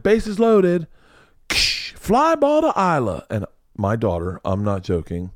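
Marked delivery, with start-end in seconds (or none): 0.0-3.2 high, slow play-by-play call; coach calling. Retold or imitated. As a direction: announce the imaginary fly ball. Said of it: "He's like".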